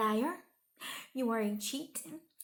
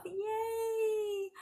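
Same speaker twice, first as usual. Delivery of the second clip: high, drawn-out cheer; celebratory; 8 semitones higher than usual. Real, not acted. Vlogger reaching the last clip, celebratory.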